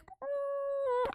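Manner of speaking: high, quiet squeamish "mm"; uneasy hum. Retold or imitated. Reacting to him licking her tears, uneasy hum.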